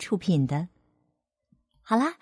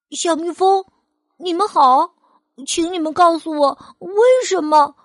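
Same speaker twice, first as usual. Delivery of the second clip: put-on high, louder little boy; child voice; 6 semitones higher, 6 dB louder than usual. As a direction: politely call out to the bees. Boy greets the bees.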